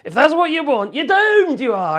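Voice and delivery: high, loud prophet of doom; mock doom warning. Real, not acted. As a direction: proclaim it like a doom-monger. Warning maga supporters.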